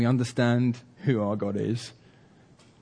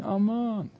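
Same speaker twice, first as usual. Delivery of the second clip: high, falling call without real volume; stretched-out searching call, held back; mock shouting; 11 semitones higher than usual. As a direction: imitate shouting from afar for a son.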